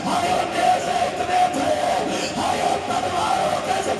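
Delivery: high breathy wail of grief; wailing cry. Acted. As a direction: break into a keening cry on hi. Lament climax, wailing cry.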